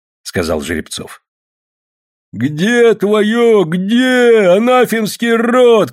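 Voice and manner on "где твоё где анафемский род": high, loud furious demand; enraged shout. A narrator's reading. Grey Old Believer grabbing a man's shirt, enraged shout. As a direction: shout it high, dragging out the curse. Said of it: "кричал седой старовер, хватая за рубаху".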